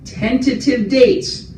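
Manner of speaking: "tentative" repeated slowly and high; stress on tentative. Real, not acted. Staff repeats the caveat to residents, slowly stressing "tentative". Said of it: "I want to stress that word again".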